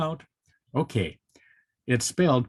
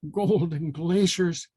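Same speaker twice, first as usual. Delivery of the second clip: slow, mild squeal; 6 semitones higher, slower than usual. A narrator's reading. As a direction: squeal it like a little hare. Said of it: "squealed Harry Hare".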